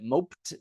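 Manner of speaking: "Moped" as "mopt", with silent e; one syllable. Shown as a demonstration. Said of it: "how do you pronounce it?".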